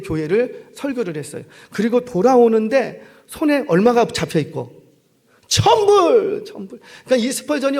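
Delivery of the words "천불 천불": high excited cry of the sum; elated shout; overjoyed. Acted. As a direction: cry out the amount in joyful disbelief. Counting money received, overjoyed.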